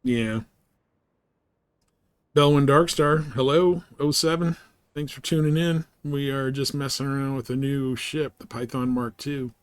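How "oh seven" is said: ordinary talk, nothing marked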